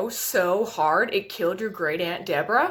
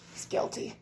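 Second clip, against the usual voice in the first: whispered accusation; 9 dB quieter than usual. Acted.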